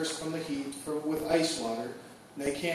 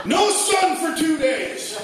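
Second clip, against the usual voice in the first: mock weatherman; 9 semitones higher, slower than usual. Weatherman voiced.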